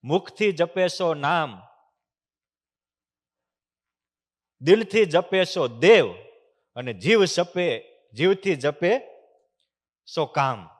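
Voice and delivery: measured, chanted verse, leaning on each line; slow reverent recitation of a saint's saying; slow recited verse. Acted. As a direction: recite the couplet slowly. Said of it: "અનુભવી સંતની અનુભવ વાણી".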